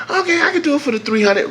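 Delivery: high put-on voice for the mechanic; mechanic's voice. Mechanic agreeing over the phone in a high put-on voice. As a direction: play the shop guy caving easily.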